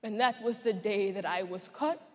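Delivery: low, hushed, halting with long pauses; sombre recollection. Acted. Survivor recalling the day she was cut, sombre and subdued. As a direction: let the voice sink quietly under the memory.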